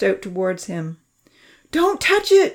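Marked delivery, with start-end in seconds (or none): 1.7-2.5 loud, high-pitched warning; sharp warning. A narrator's reading. Charlie keeps Santa off his injured arm, warning sharply. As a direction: warn her off sharply, pitched way up. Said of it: "Charlie warned her sharply".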